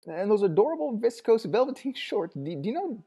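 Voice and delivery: high, tearful rush of words; high sobbing crone voice; gushing old woman. A narrator's reading. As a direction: pour it all out to the visitor. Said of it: "the torrent of words flowed sobbingly".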